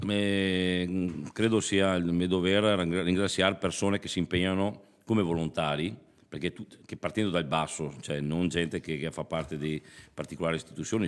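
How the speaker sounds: ordinary talk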